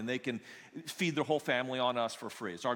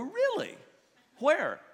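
leaps far higher in disbelief; surprised; 13 semitones higher, 5 dB louder, slower than usual. Retold or imitated. Pastor hearing Jeff met God, high mock surprise. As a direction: squeak out the disbelief.

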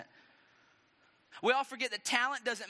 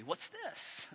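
quiet, higher-pitched indignant question; angry king; 4 semitones higher, 7 dB quieter than usual. Acted. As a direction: fume under your breath, incredulous. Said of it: "made Saul very angry".

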